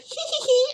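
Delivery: very high eerie mock giggle; mocking a horror-movie child laugh; creepy child giggle. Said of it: "take a child's laughter and make it terrifying".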